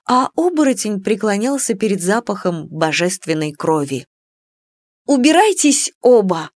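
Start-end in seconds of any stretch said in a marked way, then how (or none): 5.1-6.5 high, loud angry cry; exclamation. Acted. Heroine tells both suitors to leave, loud and high. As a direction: drive them both away. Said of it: "воскликнула я".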